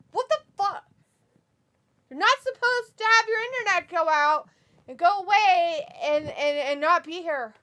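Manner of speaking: high, loud mock scolding; mock outrage. Co-host scolding a viewer whose internet died, mock outrage. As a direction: play indignant, high and loud.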